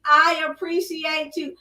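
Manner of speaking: usual speaking voice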